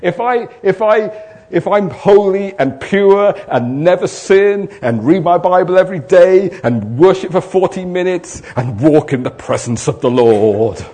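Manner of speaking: loud, driving sermon voice; preaching at full force. Acted. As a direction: preach it hard to the room. Preaching to the congregation.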